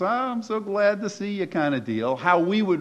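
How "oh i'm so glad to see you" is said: high-pitched, put-on friendly gush; fake gladness. Betrayer greeting the person they will betray, falsely glad. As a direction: overdo a delighted greeting in a higher voice. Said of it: "yet they were coming with this".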